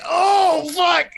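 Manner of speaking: high, loud startled yell; mock shout. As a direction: blurt it out like a shocked viewer. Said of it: "I'm like".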